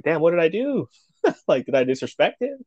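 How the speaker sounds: high, wounded disbelief; retold puzzlement. Snubbed newcomer questioning himself, puzzled.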